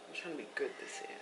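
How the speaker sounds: quick whispered aside. Real, not acted. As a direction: whisper it fast to yourself. Repeats the vow twice.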